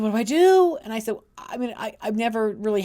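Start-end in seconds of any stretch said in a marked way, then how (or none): 0.0-0.8 panicked plea. Retold or imitated. Client asks in panic.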